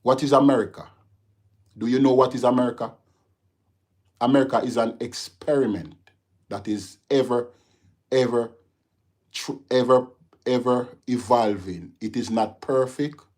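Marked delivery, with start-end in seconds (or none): 0.0-8.5 louder, higher, fast challenge; indignant outburst. Real, not acted. Streamer rebuts viewer, indignant and loud. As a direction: fire the question back at them.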